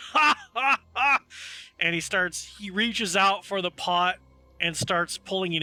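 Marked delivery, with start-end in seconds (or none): 0.1-1.2 loud, high, hammed-up ha-ha-ha; dwarf's triumphant laugh.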